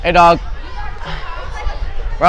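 ordinary talk

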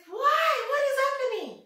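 very high-pitched imitated questioning; high, exasperated mock disbelief; exclaiming professor. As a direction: mimic an incredulous teacher, voice shooting up. Imitates professor questioning crying student.

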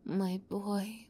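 ordinary talk

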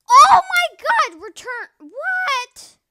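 high, loud, slow disbelief; shocked outburst. Real, not acted. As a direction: cry out in sudden shock. Sudden shock during gameplay.

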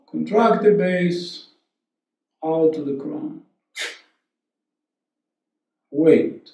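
soft, unhurried breathwork guidance; hushed yoga teacher voice during practice; guided meditation. Real, not acted. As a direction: talk them softly through each breath, leaving long pauses.

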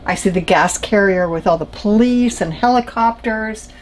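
usual speaking voice

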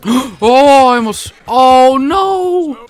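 loud, high drawn-out wail of dismay; horrified cry. Real, not acted. Player has just hit an animal, horrified cry. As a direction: wail it as disaster strikes.

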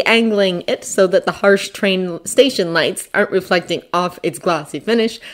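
ordinary delivery

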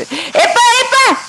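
high, drawn-out cry of alarm; startled yelp. Real, not acted. Gamer caught off guard by snakes, startled. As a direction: jump at the sneak attack.

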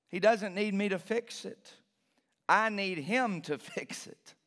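ordinary talk, nothing marked

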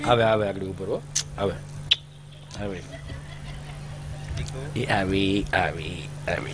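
father playing with the child, low coo; father cooing to baby.

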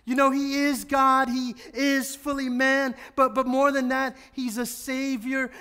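loud, high, fervent preaching. Acted. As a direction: proclaim it with rising fervour. Preaches at full force.

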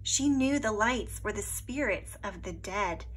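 ordinary delivery

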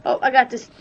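sudden loud, confident call; loud boast. Real, not acted. Gamer shouting to friends that he's got this, loud. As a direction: shout it out as you take over.

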